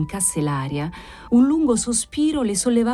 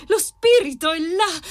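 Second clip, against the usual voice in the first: ordinary delivery, nothing marked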